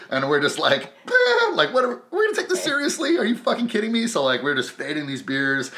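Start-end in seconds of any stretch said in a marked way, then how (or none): 1.1-4.1 very high-pitched scoffing disbelief; mock incredulous. Tipsy couple facing tiny Elvis, high incredulous scoff. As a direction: act out baffled disbelief, voice shooting up.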